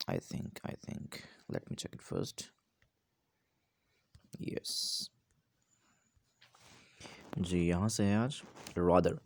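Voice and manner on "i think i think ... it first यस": whisper. Real, not acted. Teacher checks the book aside.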